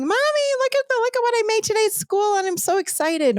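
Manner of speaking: imitating a thrilled four-year-old shouting for mommy; excited child scream. Child running in to show mom a finger painting, excited high squeal. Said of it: "and I'm screaming".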